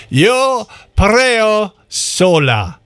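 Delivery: "Yo preo sola" sounded out very slowly, word by word; careful Spanish attempt. Real, not acted. Host announcing a Spanish title to [name], very slow, careful attempt at "Yo preo sola". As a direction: stretch out each word of "Yo preo sola" as an unsure non-Spanish speaker. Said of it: "I'm not even Spanish. How can I do it?".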